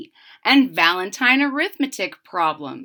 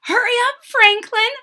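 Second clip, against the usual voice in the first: high, loud call of a hurrying mother; 7 dB louder than usual. Acted. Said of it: "said his mother".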